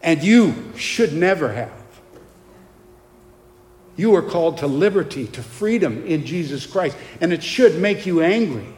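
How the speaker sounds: loud, emphatic righteous indignation; real anger. Real, not acted. Preacher denouncing deceived doctors to his congregation, angry. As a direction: preach it with forceful, heavily punched key words. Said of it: "I'm angry".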